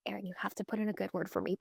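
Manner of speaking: hushed, quick, almost voiceless plea; pleading whisper. Retold or imitated. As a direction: whisper the favor like a secret begging request. Begging friend for a favor.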